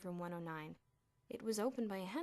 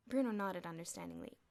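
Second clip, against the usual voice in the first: ordinary delivery, nothing marked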